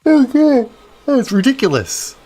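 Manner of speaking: high, loud words stretched by a yawn; talking through a yawn. Real, not acted. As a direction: let a real yawn pull the words up. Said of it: "talking about yawning makes me yawn again".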